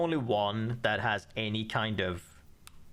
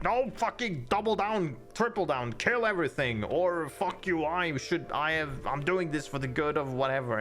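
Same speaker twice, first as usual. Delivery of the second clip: mad king ranting; 4 semitones higher than usual. Retold or imitated. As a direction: ham up a tyrant doubling down. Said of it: "and he's like".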